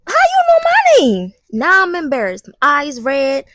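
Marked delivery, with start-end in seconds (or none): 0.1-1.3 high, loud shocked question; mock-shocked high outcry; startled suspicion. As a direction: shoot it back high and alarmed. Acted startled reply to cop.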